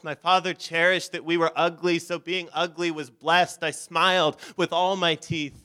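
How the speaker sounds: ordinary talk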